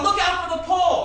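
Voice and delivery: very high, loud retold warning; imitated yelling. Dad warning the learner about a pole, imitated yelling. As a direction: yell the alarm as dad did. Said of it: "He always yelled".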